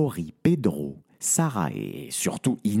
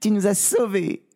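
high, elated woman's voice; joyful relief; 10 semitones higher than usual. Acted. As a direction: cheer your rescuer, pitched high. Sarah thanks her beloved rescuer. (soulagement joyeux, voix aiguë)

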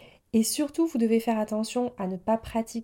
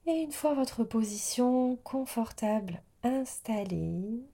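guided meditation. Real, not acted. Yoga teacher easing listeners into yoga nidra, guided meditation voice. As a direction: settle the listener gently, phrase by phrase.

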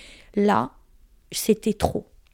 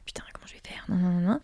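breathless whispered panic to herself; panicked whisper; 2 semitones lower, 9 dB quieter, faster than usual. Retold or imitated. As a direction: whisper it, alone and desperate. Panique seule au salon. (chuchotement paniqué)

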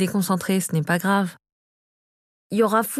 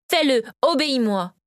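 higher-pitched insistent order; commanding; 4 semitones higher than usual. A narrator's reading. Victoria presses Conrad to obey her, commanding. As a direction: demand obedience. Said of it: "lui ordonna-t-elle".

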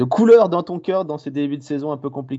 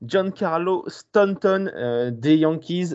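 slow, drawn-out winner reveal, pitched up; booming prize announcer; 4 semitones higher, slower than usual. Shown as a demonstration. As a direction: announce the winner like a game show host. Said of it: "roulement de tambour".

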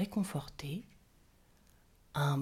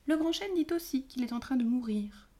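fairy Jonquille; 6 semitones higher than usual. A narrator's reading. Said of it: "répondit Jonquille".

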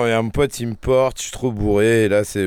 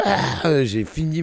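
gasping relief; 5 semitones higher, much slower than usual. Retold or imitated. After downing whole bottle.

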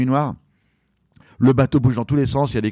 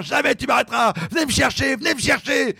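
high defiant shout, pushing higher; defiant yell; 12 semitones higher than usual. Retold or imitated. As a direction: yell it like a madman into the sea. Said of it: "Je gueule comme un malade".

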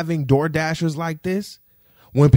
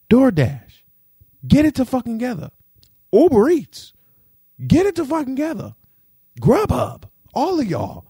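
heated scolding; 8 semitones higher than usual. Real, not acted. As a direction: call out the apps, fired up. Scolding the apps.

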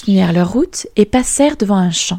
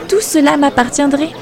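higher, wistful exclamation; exclaiming; 7 semitones higher than usual. Acted. Princess regretting the king, higher exclamation. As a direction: cry out what she missed. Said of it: "s'exclama la princesse".

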